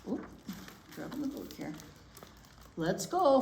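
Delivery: usual speaking voice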